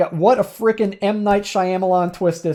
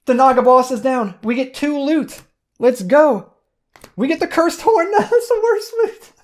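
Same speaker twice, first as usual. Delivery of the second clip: much higher, giddy with the win, laughing at the end; excited; 7 semitones higher than usual. Real, not acted. Gamer celebrating boss kill and laughing at bad loot, excited. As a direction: ride the high of the victory.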